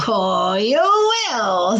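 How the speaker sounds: slow, drawn-out sly witch promise; knowing witch. A narrator's reading. Witch assuring the frog he'll feel princely, slow and sly. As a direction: stretch it out, teasing. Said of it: "She was laughing up her flapping sleeve".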